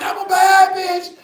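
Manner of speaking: high sing-song strut, a mock woman's voice; mock bad woman. Comedian mocking the woman's entrance, sing-song. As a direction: mimic her sashaying in.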